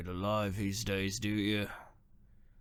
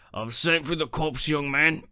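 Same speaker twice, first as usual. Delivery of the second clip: loud, higher call that he's sent for the cops; shouted threat; 6 semitones higher, 7 dB louder than usual. Acted. Caretaker warning a grave robber, raised shout. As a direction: bark it at the young man to scare him off.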